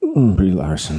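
too relaxed, resigned sigh; relieved and relaxed. Pretend. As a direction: say it like sweet relief. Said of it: "Brie Larson after you ... just shat your pants".

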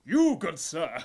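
loud, high-pitched challenge from an offended knight; shouting knight. Acted. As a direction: bellow it at the stranger like a proud knight. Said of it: "he goes".

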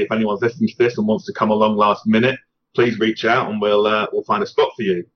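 ordinary talk

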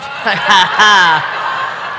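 real, loud belly laugh. Real, not acted. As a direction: burst out laughing at a joke. Laughs at the joke.

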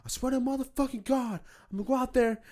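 mock high-pitched enraged yell; screaming on the phone. Armed neighbor ranting into his phone, imitated screaming. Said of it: "screaming on the phone".

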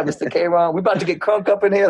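high, eager Houston schoolkid; imitated students. Students fired up calling their teacher, imitated high voice. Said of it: "what they always used to say".

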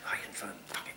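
soft unvoiced letdown; whispered disappointment. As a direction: whisper it, deflated. Disappointed thought.

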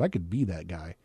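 ordinary talk

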